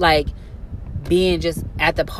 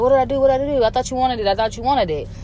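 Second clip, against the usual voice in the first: higher, whiny voice of the attacker; mock innocent man; 6 semitones higher, much faster than usual. Mimicking the man who claims she wanted it, higher. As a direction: whine it like he did nothing wrong. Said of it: "He was like".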